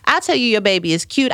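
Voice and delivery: normal talking voice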